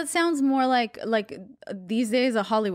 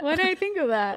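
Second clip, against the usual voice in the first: mock amazement; 4 semitones higher than usual. Sarcastic thanks for useless advice.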